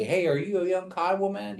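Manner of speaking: put-on whole question lifted high, "Hey, are you a young Kiowa man?"; English question inflection. Shown as a demonstration. Teacher showing how not to ask in Kiowa, inflected question. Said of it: "You don't inflect it like you do in English".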